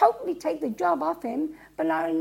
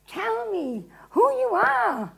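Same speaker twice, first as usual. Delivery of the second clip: high, slow, drawn-out demand; insistent questioning; 7 semitones higher, slower than usual. Acted. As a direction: press the prisoner for an answer. Pilate first questions Jesus.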